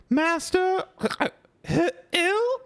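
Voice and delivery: very high, slow, faltering elf squeak; a tipsy elf stunned by the news; high tremulous house-elf, slow and halting; shaken Winky. Acted. Winky hears master ill.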